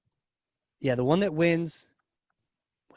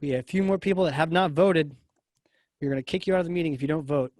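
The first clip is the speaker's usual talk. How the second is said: ordinary talk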